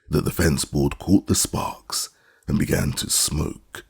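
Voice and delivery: ordinary delivery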